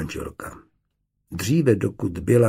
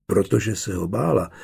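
plain reading voice

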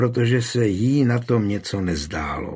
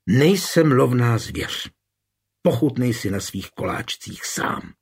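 usual speaking voice

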